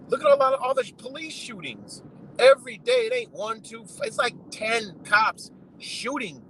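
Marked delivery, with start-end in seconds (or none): none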